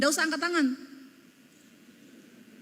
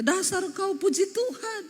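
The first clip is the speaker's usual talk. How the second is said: mock scolding; 7 semitones higher than usual. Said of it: "kalau ribut ngomongnya".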